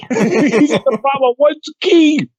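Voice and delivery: high, drawn-out parody of amazement; mock movie yell. Teller turns ski line into prom joke, high mock yell. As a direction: stretch it out like a stunned announcement.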